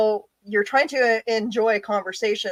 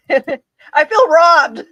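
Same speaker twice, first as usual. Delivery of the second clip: higher, louder, through laughter; laughing complaint; 8 semitones higher, 8 dB louder, much slower than usual. Real, not acted. Co-host reacting to the put-away character, laughing complaint. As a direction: laugh out a drawn-out mock grievance.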